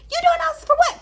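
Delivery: imitating an angry squeaky-high yell; mad, high-pitched yelling. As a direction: shoot the voice way up, loud and mad. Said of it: "when I get real mad, my voice get real, real high".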